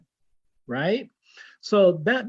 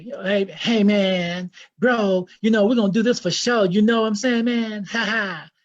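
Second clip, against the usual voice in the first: put-on American accent; 3 dB louder than usual. Egyptian brother showing off to visiting speaker, put-on American accent. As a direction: imitate a brother faking a cool American accent. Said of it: "This is my American accent".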